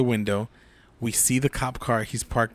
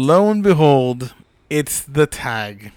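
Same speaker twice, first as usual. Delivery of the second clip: dramatic reveal; 2 semitones higher, 7 dB louder, slower than usual. Retold or imitated.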